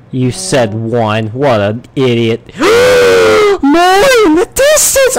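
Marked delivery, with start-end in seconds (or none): 2.6-5.2 gasp, then a much higher, louder cry; a real squeal of joy; excited gasping shout. Real, not acted. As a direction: shout out the discovery in delight. Spots more diamonds.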